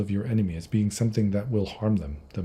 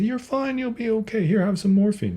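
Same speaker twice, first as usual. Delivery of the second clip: high, sing-song soothing voice, much higher; mock Western reassurance; 13 semitones higher, 4 dB louder than usual. Western caregiver to a dying patient, mock sweet and high. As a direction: coo it like a falsely cheerful nurse. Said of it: "in the West, we say".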